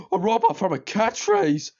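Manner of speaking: Homer. Retold or imitated. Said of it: "Homer said this".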